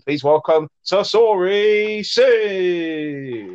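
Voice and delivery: slow, high, drawn-out showman announcement; announcer voice. Pretend. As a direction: announce him like a ring MC. Introducing guest, showman style.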